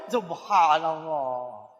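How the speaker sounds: very slow, drawn-out Subhanallah; long reverent sigh of praise; awed exclamation. Real, not acted. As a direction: stretch it in wonder. Marvelling at cheap bicycle.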